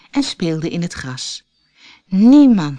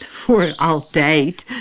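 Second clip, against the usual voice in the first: amused laugh; 3 semitones lower, much slower than usual. Acted. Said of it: "lachte Clara's grootmoeder".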